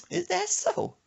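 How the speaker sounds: put-on high, skeptical old lady voice; a sassy grandma drawing out the question; grandma's high voice. Grandma questioning Brandon, high and skeptical.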